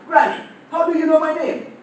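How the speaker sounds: high, loud stunned question; shocked outburst. Retold or imitated. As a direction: blurt it out in disbelief at seeing granny. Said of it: "my mouth just opened and uttered these words".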